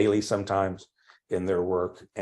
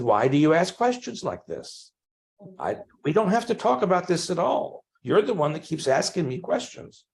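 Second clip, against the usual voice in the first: higher, pushing back in frustration; retelling an irritated answer to the bishop, pitched up; exasperated retort; 10 semitones higher, 3 dB louder than usual. Retold or imitated. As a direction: fire the questions back at him, fed up. Retold exasperated comeback to bishop.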